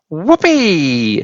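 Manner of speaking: high, wild whoop of joy; gleeful whoop. A narrator's reading. Grandma shouts with glee as she grows through the floor. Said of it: "she shouted".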